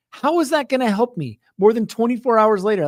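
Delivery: high, incredulous complaint; exasperated. Retold or imitated. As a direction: push back in disbelief. Frustrated reply to agent.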